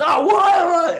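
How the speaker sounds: high loud indignant shout; heckler yelp. Retold or imitated. Heckler shouting back high and loud in outrage. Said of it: "he goes".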